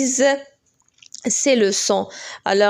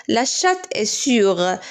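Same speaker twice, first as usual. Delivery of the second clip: slow dictation read; much slower than usual. Shown as a demonstration. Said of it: "teacher reads sentence slowly".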